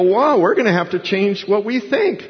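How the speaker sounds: high-pitched retold wonder; imitated amazed listeners. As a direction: voice their astonishment, pitched well up. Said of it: "they go away and they go".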